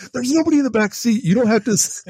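high-pitched mock exasperated telling-off; mock scolding. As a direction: imitate a friend getting mad, pitch way up. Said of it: "you got mad".

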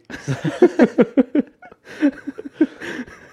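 high burst of laughter; loud high laugh; big laugh. Real, not acted. Laughs at joke.

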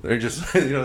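ordinary talk, nothing marked

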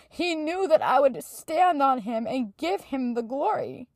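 choked-up, high testimony; crying. Real, not acted. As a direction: testify through real tears. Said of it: "because I'm crying".

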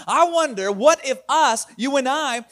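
ordinary talk, nothing marked

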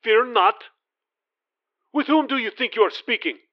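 a prince insulted by his hired man, angry; indignant prince. Acted. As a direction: throw his own words back at him in outrage. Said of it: "The voice was no longer whispering".

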